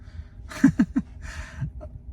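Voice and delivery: squeaky unvoiced giggle; high wheezy laugh; high breathless laugh. Real, not acted. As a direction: giggle at the absurdity. Laughs after the question.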